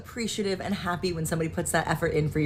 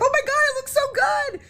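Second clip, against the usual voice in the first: very high, thrilled gushing; excited squeal. Retold or imitated. Wife squealing with joy over husband's vanity. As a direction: squeal with delight at the gift. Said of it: "I was like".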